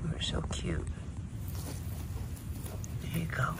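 soft whispered coo at a cute animal. Real, not acted. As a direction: whisper it adoringly to the little turtle. Cooing at a turtle.

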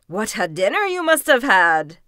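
loud, impressed wolf; exclaiming wolf. A narrator's reading. Wolf seeing the overfed fox, loud exclamation.

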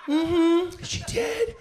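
way up high, with a laugh; squeaky high aside. Acted. Telling the crowd about Nana humming, squeaky high. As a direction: squeak it like a giggle.

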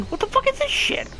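very high squeal; high squeaky imitation. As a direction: shriek it like the character. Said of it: "we're gonna be saying that".

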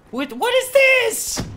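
very high, slow squeaky bafflement; shocked disbelief. Real, not acted. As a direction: sound totally thrown by what you see. Confused by new weapon.

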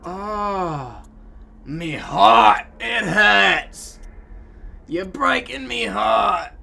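hammed-up wail of a broken heart; mock heartbreak. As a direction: overact a broken heart, slowing on the last line. Mock heartbroken Irish-ish lament.

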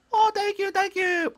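very high-pitched little bunny voice; squeaky grateful bunny. Acted. Bunny thanking the person who picked it, squeaky and grateful.

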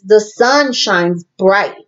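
ordinary talk

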